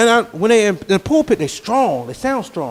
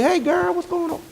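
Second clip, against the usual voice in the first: friendly casual greeting; 9 semitones higher than usual. Retold or imitated. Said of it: "I walk up to you".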